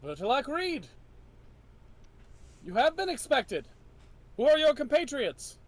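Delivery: high, rising guard's welcome; sentry greeting from the towers. Acted. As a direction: call down from the wall. Guard replies to knight.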